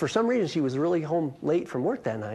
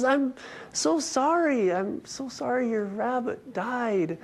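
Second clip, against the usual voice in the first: mock consoling; 7 semitones higher, slower than usual. Teller consoling neighbor.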